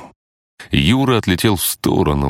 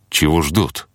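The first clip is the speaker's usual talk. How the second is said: usual speaking voice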